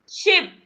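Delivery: शिप starting with a hushing sh, not सिप; sh in ship. Shown as a demonstration. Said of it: "सिप नहीं बोलना है". (शिप में श, स नहीं)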